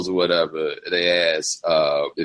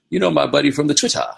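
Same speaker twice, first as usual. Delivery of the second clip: put-on Trevor Noah accent; 9 semitones higher than usual. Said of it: "a South African accent is not an accent you hear very often".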